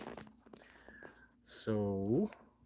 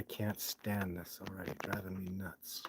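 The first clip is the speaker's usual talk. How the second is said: whispered annoyance; 4 semitones lower, 10 dB quieter, slower than usual. Real, not acted. Muttering annoyance at vertical video.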